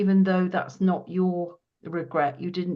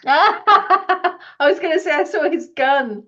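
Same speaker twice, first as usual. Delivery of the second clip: bursts out laughing, high and loud; 10 semitones higher, 11 dB louder than usual. Real, not acted. Medium laughing as the sitter confirms the gun. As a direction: laugh it out in recognition.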